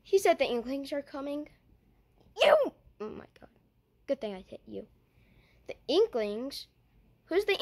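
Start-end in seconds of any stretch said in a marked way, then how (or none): 2.4-2.7 sudden high loud cry of You!; startled yell. Pretend. Toy figure yelling at friend in surprise, loud startled cry. 3.0-4.9 hushed whisper. Pretend. Figure whispering to itself after hitting friend, secret whisper.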